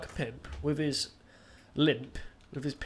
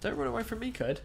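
taunting; 7 semitones higher than usual. Real, not acted. Taunting enemy in game.

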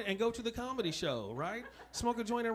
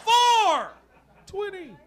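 a loud, higher blurted four twenty; mock triumphant call; 11 semitones higher, 9 dB louder, much slower than usual. Stopped by police, he loudly announces four twenty. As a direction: belt the answer out like a proud reveal. Punchline answer to being pulled over.

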